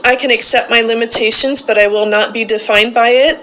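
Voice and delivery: loud call-and-response recitation, each line declared for the group to repeat; loud group-leader recitation; leading a creed. Acted. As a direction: proclaim it to the room like a rallying creed. Leads daily creed recitation.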